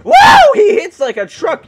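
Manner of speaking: very high, loud yelp; startled shout. Real, not acted. Gamer knocked flying by giant ape, startled shout. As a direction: cry out as if struck.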